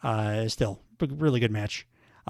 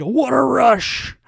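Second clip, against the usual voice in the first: excited; 9 semitones higher, 7 dB louder, slower than usual. Real, not acted. Excited commentary.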